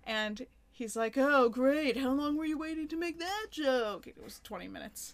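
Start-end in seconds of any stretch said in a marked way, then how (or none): 1.2-4.1 louder, higher mock-impressed retort; sarcastic comeback. Retelling his comeback to a friend's joke, sarcastic. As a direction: imitate an exasperated man's sarcasm.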